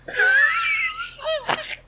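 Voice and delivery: very high, squealing laugh; shrieking laugh. Real, not acted. Host breaking up at the great idea, shrieking laugh.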